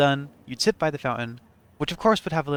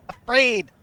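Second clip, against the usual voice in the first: nervous sleepy possum; 11 semitones higher, 7 dB louder than usual. Acted. Reading opossum's mood.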